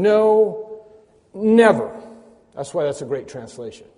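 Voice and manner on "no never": "no" held high, a beat, then loud "never"; high, loud, absolute "no", then "never"; emphatic double negative. Shown as a demonstration. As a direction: split "no" and "never" with a pause. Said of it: "it's like saying".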